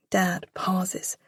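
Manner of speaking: plain reading voice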